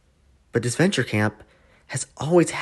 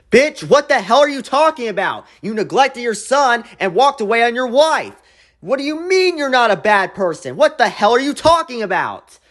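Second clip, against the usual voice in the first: exasperated rant; 9 semitones higher than usual. Acted. Youtuber yelling at Alec onscreen, high and indignant. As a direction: tear into him like he can hear you.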